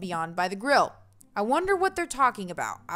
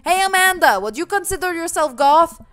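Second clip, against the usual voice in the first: loud, raised call across a distance; shouted question, pitched up; 7 semitones higher, 10 dB louder than usual. Acted. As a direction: holler to someone across the yard. Calling across the party to daughter.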